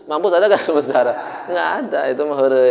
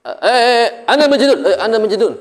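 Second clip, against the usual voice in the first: high, loud startled blurting; latah outburst; 9 semitones higher, 5 dB louder than usual. Retold or imitated. Teacher imitating a startled latah person, high startled blurt. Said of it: "Ini orang latah berarti".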